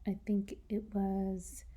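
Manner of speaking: normal talking voice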